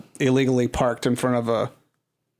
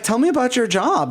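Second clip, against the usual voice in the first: mock flirty; 10 semitones higher than usual. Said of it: "so I was like".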